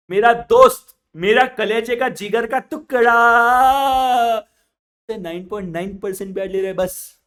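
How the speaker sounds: loud, high weeping that builds; mock crying. Punjabi weeping to elder baniya about the interest. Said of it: "Punjabi तो रो रहा है".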